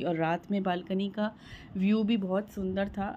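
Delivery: ordinary talk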